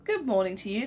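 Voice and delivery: usual speaking voice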